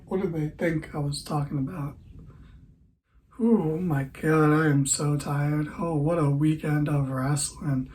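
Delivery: ordinary talk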